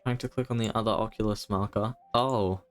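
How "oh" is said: ordinary delivery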